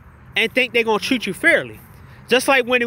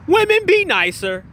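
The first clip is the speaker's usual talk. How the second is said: high-pitched mock plea; raised, drawn-out mocking imitation; mocking quote; 8 semitones higher than usual. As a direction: mimic someone mockingly, pitched up. Mocks the played clip's line.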